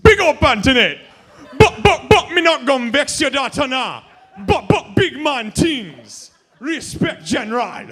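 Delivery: high, loud, shouted Jamaican patois; hammed-up Jamaican patois. Boyfriend greeting girlfriend's Jamaican mother, shouting in exaggerated patois. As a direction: bellow it like an over-the-top Jamaican dancehall MC. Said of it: "with a flawless patois Jamaican accent".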